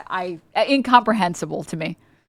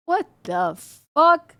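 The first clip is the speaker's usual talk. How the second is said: exasperated disbelief; 7 semitones higher, slower than usual. Real, not acted. Exasperated at the idea.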